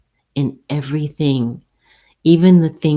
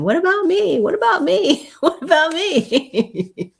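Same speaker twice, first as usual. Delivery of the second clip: much higher squeaky plea from forgotten stuff; a needy little object's voice, giggling by the end; voice of neglected clutter. Acted. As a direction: play the junk whining for attention, dissolving into laughs. Clutter voiced begging for attention.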